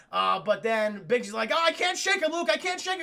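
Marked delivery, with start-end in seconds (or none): 1.5-3.0 high panicky pilot cry; mock panic. Biggs to Luke with fighter on his tail, mock panic. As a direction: call for help in hammed-up panic.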